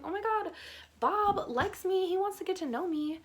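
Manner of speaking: put-on high, thrilled squeal of a smitten teen; giddy excitement. Teen girl to herself about her crush, giddy and high. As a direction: gush like a girl who just got noticed. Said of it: "I was kinda just like".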